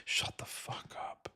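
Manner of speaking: hissed whisper, unvoiced. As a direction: spit it under your breath. Venting at congressmen.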